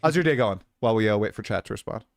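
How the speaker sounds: normal talking voice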